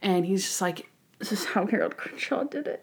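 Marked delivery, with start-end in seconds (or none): 1.2-2.8 soft, under the breath; small, subdued imitation; muffled acted question. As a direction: quote it quietly, as if barely getting it out. First, quieter take of Monk's question.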